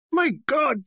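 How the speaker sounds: high startled exclamation; mock alarm. A narrator's reading. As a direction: cry out in comic dismay. Spectator cries out at singing.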